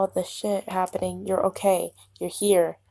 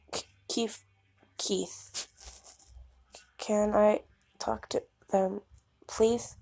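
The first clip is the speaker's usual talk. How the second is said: very soft, hesitant plea; timid whisper; 8 dB quieter, slower than usual. Acted. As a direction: ask Keith meekly.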